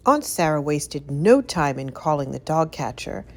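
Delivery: ordinary delivery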